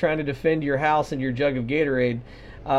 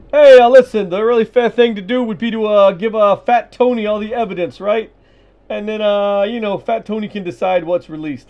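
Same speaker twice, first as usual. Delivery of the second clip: high, loud wiseguy pitch; hammed-up mob fixer, much higher; mock mobster voice; 6 semitones higher, 8 dB louder than usual. Mock mob investigator talking.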